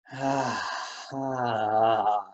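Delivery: long, low relieved sigh; big sigh. Shown as a demonstration. Coach models a deep sigh for the class. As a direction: let it all out in a heavy sigh. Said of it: "letting out a big sigh".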